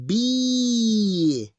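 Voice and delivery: teacher modelling a long vowel. Shown as a demonstration. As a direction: stretch out Be. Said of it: "Long sound".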